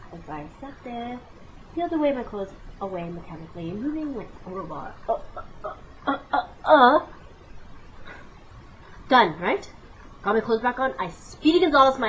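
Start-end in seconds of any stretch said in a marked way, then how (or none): 5.1-7.1 breathy rising giggle that bursts loud; awkward laugh. Real, not acted. Laughing at her own awkward hookup. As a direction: laugh it off, embarrassed.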